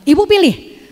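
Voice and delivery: retold cocky challenge; cocky ultimatum. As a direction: repeat the ultimatum like a mock tough guy. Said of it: "jagoan banget". (menirukan tantangan sok jagoan)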